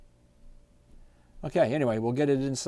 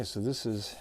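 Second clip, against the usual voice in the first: ordinary delivery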